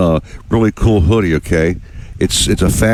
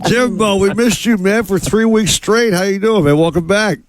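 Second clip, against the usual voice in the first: excited greeting; 11 semitones higher than usual. Real, not acted. Greeting guest on air.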